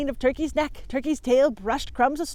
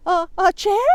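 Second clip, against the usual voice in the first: high, louder nervous stammer; 10 semitones higher, 8 dB louder than usual. Acted. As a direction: stumble on the words, alarmed. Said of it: "Turkey stammered".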